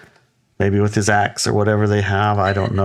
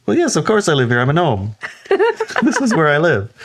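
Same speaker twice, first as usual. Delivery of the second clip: matter-of-fact gnome; 8 semitones higher than usual. Retold or imitated. Gnome answers an obvious question.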